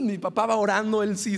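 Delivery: ordinary talk, nothing marked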